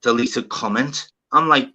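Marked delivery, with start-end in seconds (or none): none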